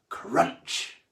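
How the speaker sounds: loud biting crunch. A narrator's reading. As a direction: snap out the bite. Shark bites.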